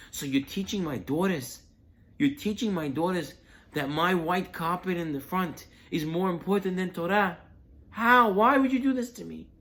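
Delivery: loud, high scolding building to a cry; indignant outburst. Retold or imitated. Host scolding the rabbi about the carpet, building loud outcry. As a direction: pour out the grievance, rising to a shout.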